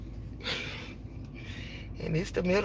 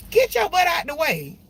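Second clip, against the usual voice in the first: high, louder bossy command; mock bossy yell; 8 semitones higher, 4 dB louder than usual. Driver telling someone to move, a mock bossy yell. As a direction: bark at someone blocking the road. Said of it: "to say".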